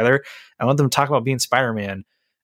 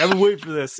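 a hint of a put-on higher voice; mock Jesus voice; 5 semitones higher than usual. Podcaster joking about Christ at the movies, in a lightly put-on voice. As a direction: lightly voice him as eager. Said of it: "He's like".

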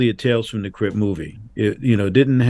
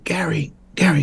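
hushed, voiceless whisper of the name; groggy whisper as if woken in the night; sleepy whisper; 7 semitones higher than usual. Retold or imitated. Woken director whispers into the phone at night.

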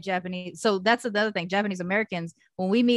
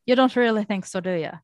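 put-on a lilting Irish accent, a little louder; 3 semitones higher, 5 dB louder than usual. Friend demonstrating the Irish accent to listeners, lilting Irish. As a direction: play the Irish speaker, teasing.

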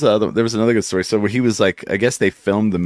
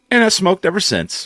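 quoting the actor; 12 semitones higher than usual. Retold or imitated. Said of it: "And he goes".

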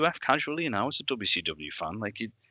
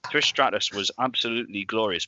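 usual speaking voice

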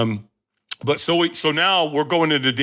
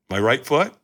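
ordinary talk, nothing marked

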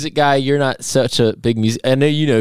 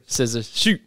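ordinary talk, nothing marked

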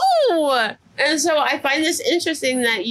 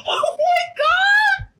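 very high, stretched tearful cry; real shocked near-crying squeal; composure lost; much slower than usual. Real, not acted. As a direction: squeal it out, overwhelmed. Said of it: "composure has completely changed".

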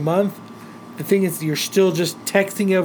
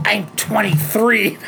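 slow, stretched indignant correction; mildly hammed-up affront; mock offence; 3 semitones higher, slower than usual. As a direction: imitate someone insulted, drawing it out. Retold offended woman correcting his guess.

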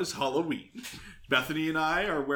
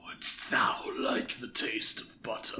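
slow, whispered monster rasp; 9 semitones lower, 7 dB quieter, slower than usual. Acted. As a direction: whisper it slowly like a lurking monster. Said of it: "He's not like a monster".

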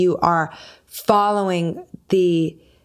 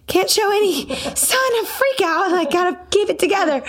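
much higher, quick, strained self-talk with a laugh; panicked inner voice; 13 semitones higher than usual. Retold or imitated. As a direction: squeak out your panic while pretending calm. Inner panic voice, hiding worry.

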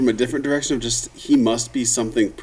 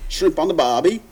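mock Australian; 6 semitones higher than usual. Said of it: "brush up on your Australian accent".

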